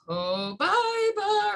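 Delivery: "cobaiba" stretched out high, co-BAAI-ba; exaggerated pronunciation. Shown as a demonstration. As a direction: sing out "cobaiba" very slowly, pitch soaring on BAI. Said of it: "however you pronounce it".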